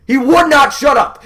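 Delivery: rising to a loud shout of frustration; exasperated rant. Real, not acted. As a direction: vent at the dog, building to a yell. Ranting about the barking dog.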